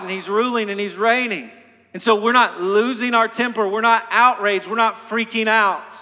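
preaching at full force. Acted. Preacher rallying the congregation against fear, fervent. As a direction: proclaim the risen king with fire. Sermon climax on Christ's reign.

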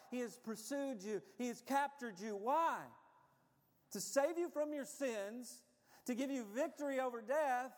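building, emphatic sermon delivery; impassioned preaching. Real, not acted. Preacher telling congregation what Christ did for them, impassioned. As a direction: drive each phrase home, rising in pitch.

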